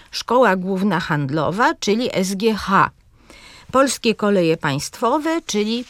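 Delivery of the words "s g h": "S G H" as es-gie-HA; stress last syllable. Shown as a demonstration. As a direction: spell out "S G H", leaning on the final letter. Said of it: "akcentujemy zawsze na ostatniej sylabie". („S G H” z akcentem na HA)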